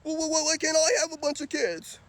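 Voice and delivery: high whiny mock female voice; hammed-up high nagging woman, dragging the last words. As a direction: mimic a whining woman arguing back. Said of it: "Women don't talk".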